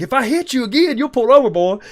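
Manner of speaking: mock tough-guy yell at the driver; shouted threat. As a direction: holler the threat across the road.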